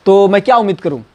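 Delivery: normal talking voice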